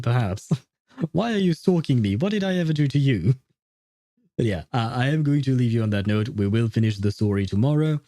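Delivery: ordinary talk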